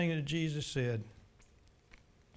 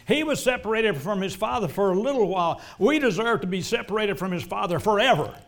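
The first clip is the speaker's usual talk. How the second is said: rising fervent sermon voice; building to full-force preaching; 6 semitones higher, 7 dB louder than usual. Real, not acted. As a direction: preach the guilt home to them.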